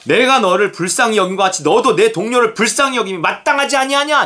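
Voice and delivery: high-pitched acted scolding; stern rebuke. Master to unforgiving servant, stern rebuke. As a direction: climb in pitch to a reproachful question.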